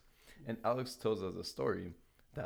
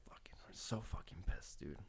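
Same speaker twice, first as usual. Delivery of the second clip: a hushed whisper of frustration; angry whisper; 8 dB quieter than usual. Retold or imitated. Stranded at a Polish bus stop, whispers his frustration. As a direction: mutter it under your breath, fed up.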